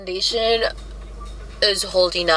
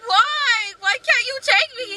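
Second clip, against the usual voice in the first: put-on high whiny why, like a child; whiny high pleading; slower than usual. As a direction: whine it like a sulking kid. Whining request retold.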